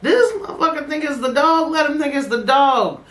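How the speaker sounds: mock crying. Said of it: "mock crying plea".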